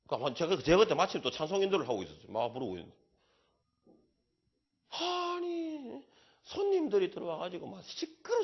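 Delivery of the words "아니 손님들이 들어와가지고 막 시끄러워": high, drawn-out mock whine; mock complaint. Retelling the motel woman's complaint, mock drawn-out whine. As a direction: imitate the annoyed woman, stretched slow.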